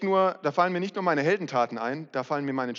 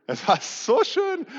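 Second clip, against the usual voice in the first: dreamy savouring; 13 semitones higher, 6 dB louder, slower than usual. Retold or imitated. Savouring a past indulgence.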